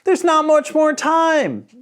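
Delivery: put-on high, slow, loud child's voice; anxious kid calling out; little boy pleading. A narrator's reading. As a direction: beg for time as a worried boy. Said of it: "shouted the little boy".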